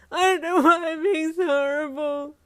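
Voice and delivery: high loud wailing sob; sobbing. A narrator's reading. Yin sobbing to Ida about being horrible. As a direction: cry out in self-reproach. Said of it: "you sobbed".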